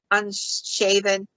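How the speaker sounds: usual speaking voice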